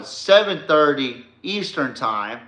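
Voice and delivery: normal talking voice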